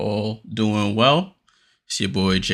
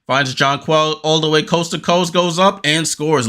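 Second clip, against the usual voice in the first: excited call; 6 semitones higher than usual. Acted. Calling a fast-break score.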